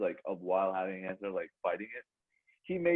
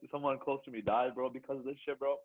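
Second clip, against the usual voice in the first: ordinary delivery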